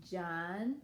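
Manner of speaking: usual speaking voice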